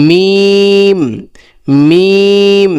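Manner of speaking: মিম held long from closed lips; letter demo. Shown as a demonstration. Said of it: "তারপরে কি?".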